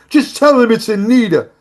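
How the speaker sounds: put-on loud, high, brash New York accent. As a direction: call out like a tough New York beauty queen. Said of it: "she has a big New York accent".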